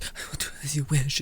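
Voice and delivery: soft, quick, meek little yes-sir reply; meek compliance. Retold or imitated. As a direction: mumble "I will do as you wish" fast and small, like a pushover. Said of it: "Then there's this where he's like".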